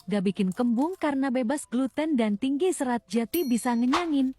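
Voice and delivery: ordinary talk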